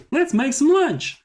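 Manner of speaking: very high, loud put-on cartoonish voice; Alex. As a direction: play him in a piercingly high character voice. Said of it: "What's the voice we gave him?".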